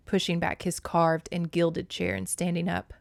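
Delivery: plain reading voice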